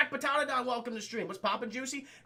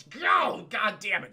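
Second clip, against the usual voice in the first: mock angry outburst; 5 dB louder, slower than usual. Boss dismissing his employee, mock angry shout. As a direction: play an exasperated boss blowing up.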